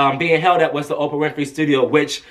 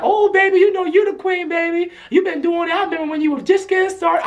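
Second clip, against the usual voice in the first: high-pitched imitated diva gushing; 12 semitones higher than usual. Older star returning [name]'s praise, high gushing imitation. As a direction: coo back in a high diva voice. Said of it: "And [name]'s like".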